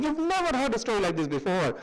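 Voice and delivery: normal talking voice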